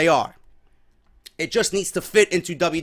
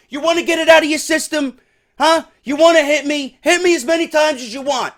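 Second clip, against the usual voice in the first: higher, louder mock challenge; agitated challenge; 9 semitones higher, 5 dB louder than usual. Seth daring Dean to swing the chair, heated mock challenge. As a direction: dare him, heated. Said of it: "So he says".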